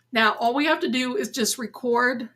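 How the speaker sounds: ordinary talk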